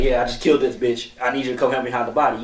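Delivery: matter-of-fact guy confessing to a buddy; guy best friend. Pretend. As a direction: casually ask a friend for help, like a guy. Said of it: "your best friend's a, a guy, he's like".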